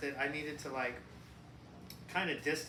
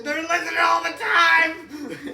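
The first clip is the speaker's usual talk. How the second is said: high, loud laughing rant; ranting shout; 12 semitones higher, 16 dB louder, slower than usual. Pretend. Mock rant that the government hears nothing, shouting. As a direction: scream it like a conspiracy nut.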